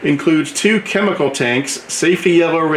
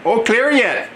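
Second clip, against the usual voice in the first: hammed-up Wisconsin accent, pitched higher; fake Wisconsin accent; 9 semitones higher than usual. Vlogger jokingly names the toy maker's hometown, in a mock Wisconsin accent. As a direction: stretch out Eau Claire like a mock Wisconsinite, up high. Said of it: "that's my fake Wisconsin accent".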